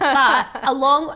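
talking through a cookie; chewing while speaking; mouth full. Real, not acted. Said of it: "I'm finishing the cookie".

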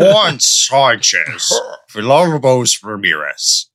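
slow, lightly done Scottish Connery accent on the name; Sean Connery accent. Shown as a demonstration. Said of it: "in his accent, please?".

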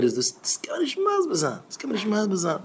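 very high, soft little voice; mimicked disbelief. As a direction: squeak it out softly, far above normal pitch. Acting out an incredulous reaction. (הויך און שטיל נאכגעמאכט)

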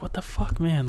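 a high, hushed, stunned "what the"; stunned disbelief. Retold or imitated. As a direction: caught off guard, mildly voicing the shock. Stunned during the earthquake.

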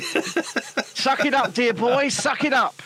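teasing scold, lightly voiced; mock scold. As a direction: tell him to toughen up, half laughing. Mock scolding of his friend.

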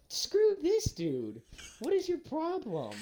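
high, slow, indignant; mock outrage. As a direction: drag it out like you're fed up. Boys' angry retort, retold.

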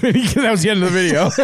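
laughing while talking, quick. Real, not acted. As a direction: crack up mid-sentence. Laughing through story end.

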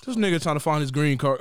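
ordinary talk

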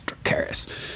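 low, hushed one-word order; imitating a queen's whispered order; whispered command. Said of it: "and then she goes".

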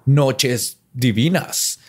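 high, hammy evil villain voice, cackling; mock villain. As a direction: ham up a wannabe edgy villain. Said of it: "tratando de verse malo".

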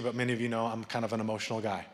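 normal talking voice

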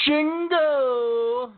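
high, drawn-out cry of the name; anguished shout. Real, not acted. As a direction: yell the name in mock agony.

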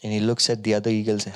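ordinary delivery

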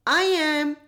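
high, light little voice; tinkly bell voice. Acted. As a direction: ring it out like a tiny bell. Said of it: "replied a tinkling voice".

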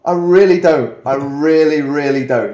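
loud, high mock-frightened protest; hammed-up frightened kid, stressing each refusal; panicked pleading. Retelling his scared teenage plea.

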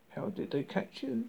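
ordinary delivery, nothing marked

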